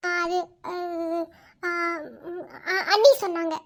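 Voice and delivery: hesitant, soft, halting words; shy stammer. Acted. Young woman hesitantly explaining why she came, nervous. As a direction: stumble over the words nervously.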